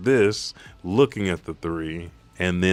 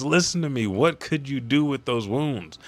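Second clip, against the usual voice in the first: higher-pitched acted reproach; mock urgent scolding; 7 semitones higher than usual. Ally telling wounded Luffy to listen, acted urgent scolding. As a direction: plead with a stubborn friend, pitched up.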